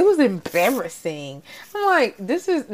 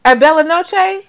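heated exclamation; 7 semitones higher, 3 dB louder than usual. Real, not acted. Friend ranting about the Oscars slap, rising indignant disbelief. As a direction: voice climbing higher and louder in disbelief.

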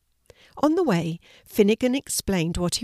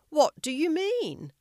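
high, slow, airy question; puzzled antelope; 9 semitones higher than usual. A narrator's reading. Antelope questioning Jonah, high and puzzled. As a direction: ask slowly, pitch climbing in puzzlement.

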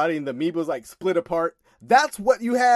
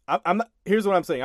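ordinary talk

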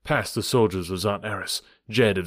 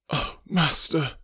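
crying out; 7 semitones higher, slower than usual. Acted. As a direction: cry it out to the master. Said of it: "cried one".

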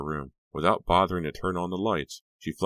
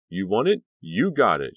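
shouted hype to the crowd; shouting; 5 semitones higher, 7 dB louder than usual. Acted. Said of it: "she shouted".

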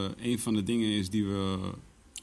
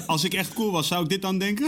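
paranoid high question; 7 semitones higher than usual. Retold or imitated. Paranoid stoned self-questioning.

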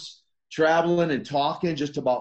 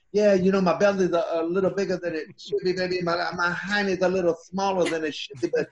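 a hint of Dusty cutting a promo; Dusty Rhodes impression; 2 semitones higher than usual. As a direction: lightly sell it like a lazy big-man promo, baby. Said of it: "he could just roll with the interviews".

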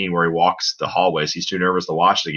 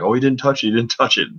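mock excited yell; 5 semitones higher than usual. Podcaster retelling his reaction to the trophy moment, mock excited yell. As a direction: imitate your own excited holler at a mild volume. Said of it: "you hear me, like, yell".

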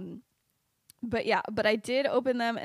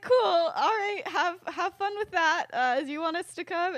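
high-pitched, louder imitation of the friends; acted breezy send-off; 7 semitones higher, 4 dB louder than usual. Friends seeing Remus off to Dumbledore, in a high mock-breezy voice. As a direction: play the friends chirping their okay, pitched well up.

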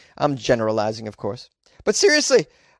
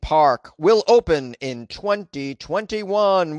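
announcer voice; 5 semitones higher, slower than usual. Acted. Announcer read of headline.

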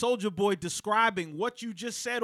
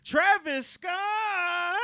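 very slow, higher incredulous rise; drawn-out disbelief; 10 semitones higher, much slower than usual. Retold or imitated. Host quoting the rapper for the listeners, slow incredulous. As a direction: stretch the name out mockingly. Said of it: "Get ready".

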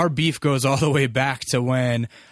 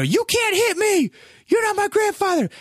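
put-on much higher wailing child voice; child screaming. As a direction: shriek like a spanked little boy. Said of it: "started screaming bloody murder".

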